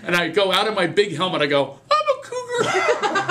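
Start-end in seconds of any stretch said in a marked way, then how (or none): 1.9-3.3 put-on very high, slow squeaky kid voice; high squeaky eager boy. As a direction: squeak it out like a proud little boy. Kid proudly announces himself.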